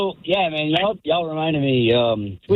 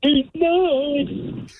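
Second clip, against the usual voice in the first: punchline voice; 11 semitones higher than usual. Retold or imitated. Caller delivers punchline to Drew.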